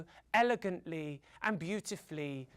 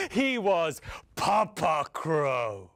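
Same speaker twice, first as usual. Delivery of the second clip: slow, low, weighty naming; big strong crow; 4 semitones lower, 4 dB louder, slower than usual. A narrator's reading. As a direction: announce the mighty father crow slowly. Introduces big strong father crow.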